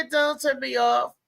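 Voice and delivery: normal talking voice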